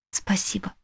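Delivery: беззвучный нежный шёпот; прошепчи благодарность на ухо. A narrator's reading. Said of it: "прошептала". (шёпотом)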